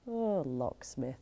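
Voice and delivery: soft, low, slow sigh on LOCKSMITH; wistful longing. Acted. Thinking back on her old crush, wistful longing. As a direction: sigh out LOCKSMITH, dreamily drawn out. Sighs over the remembered crush.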